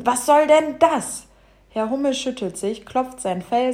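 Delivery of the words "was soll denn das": loud, higher, drawn-out protest; affronted storybook bumblebee; indignant outburst. Acted. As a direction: burst out in slow indignation. Bumblebee hit by falling earth.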